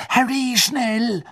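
hiss-free urgent plea from behind a tree; frightened girl urging her friend; urgent girl's call. Acted. Hermine calls urgently.

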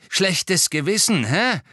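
loud, high, drawn-out taunt; snorting. A narrator's reading. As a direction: snort it gloatingly. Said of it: "schnaufte er".

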